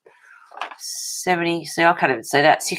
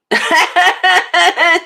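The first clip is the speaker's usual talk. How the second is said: bright laugh, much higher and louder; high delighted laugh; 13 semitones higher, 7 dB louder than usual. Real, not acted. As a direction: laugh out loud at a friend's joke. Laughing at friend's comment.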